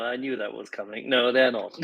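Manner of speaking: ordinary talk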